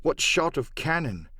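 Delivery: usual speaking voice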